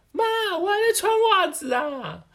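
hammed-up whiny child; son whining. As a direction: whimper like a stuck kid. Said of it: "然后说".

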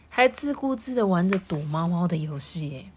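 ordinary delivery

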